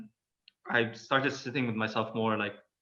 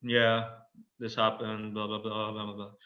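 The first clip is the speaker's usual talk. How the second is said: understated, disengaged recap, sinking quieter and lower; bored monotone; 3 semitones lower, 4 dB quieter than usual. Retold or imitated. Client recapping the week to the therapist, bored and fading. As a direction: mumble through it listlessly, trailing off softer. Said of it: "I'm like".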